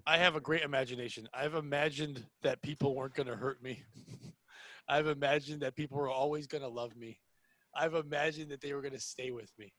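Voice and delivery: low, soft, deadpan hurt; mock-wounded confession. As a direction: confess old hurts quietly, playing it straight.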